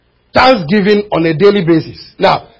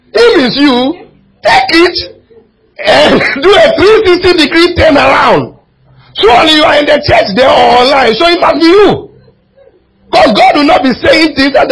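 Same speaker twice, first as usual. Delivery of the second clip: rising, loud preacher's cry; impassioned shout; 7 semitones higher, 4 dB louder than usual. Real, not acted. Pastor shouting at the congregation to turn around, impassioned cry. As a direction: drive it home to the whole church.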